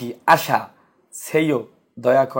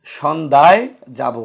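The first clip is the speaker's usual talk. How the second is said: slow, with সন্ধ্যায় leaned on; teacher correcting সন্ধ্যা যাব; stress on সন্ধ্যায়; 5 dB louder than usual. Shown as a demonstration. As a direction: press the ending of সন্ধ্যায়. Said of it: "আমরা কি বলি সন্ধ্যা যাব".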